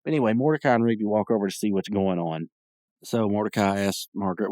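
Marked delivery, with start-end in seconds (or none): none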